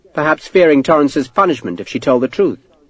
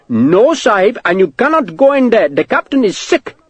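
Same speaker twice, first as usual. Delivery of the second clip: put-on high, urgent accented clerk; alarmed clerk; 9 semitones higher than usual. Clerk stopping Sharp at the door, alarmed. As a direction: block him with a high-pitched protest.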